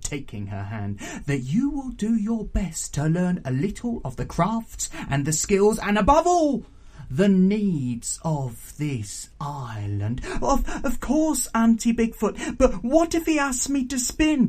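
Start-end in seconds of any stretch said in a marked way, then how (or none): 1.3-10.2 emphatic aunt exacting a promise, slowing at the end; stern raucous auntie. 10.4-14.5 high, stammering anxious plea; begging. A narrator's reading. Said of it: "begged Pignut".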